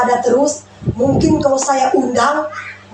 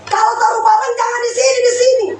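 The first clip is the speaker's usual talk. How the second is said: high, fast nagging scold; mock scolding husband; 11 semitones higher than usual. Wife voicing her husband scolding her, high and fast. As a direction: nag about where things are left.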